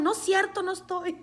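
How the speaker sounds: high-pitched yelled denial; reenacted frantic shout; retold yell. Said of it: "And then I yelled".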